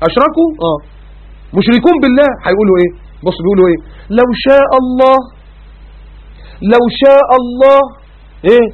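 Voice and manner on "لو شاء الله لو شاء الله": slow, drawn-out melodic recitation; Quranic recitation. Acted. Preacher reciting the verse to the congregation, slow melodic chant. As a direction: chant the verse slowly, stretching each word.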